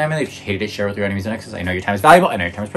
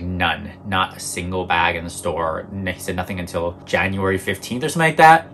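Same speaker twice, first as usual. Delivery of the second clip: ordinary talk, nothing marked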